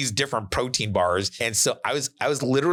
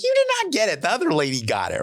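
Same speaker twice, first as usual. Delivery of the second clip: mocking; 11 semitones higher than usual. Teasing his buddy who misspelled the domain, mock squeal. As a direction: squeak it at him to rub it in.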